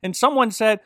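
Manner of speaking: ordinary talk, nothing marked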